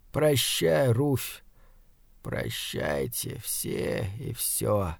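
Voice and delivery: very soft, fading to a low, slow murmur; barely voiced last farewell, slowing right down; hushed farewell. A narrator's reading. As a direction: let the goodbye die away. Wounded man's silent farewell.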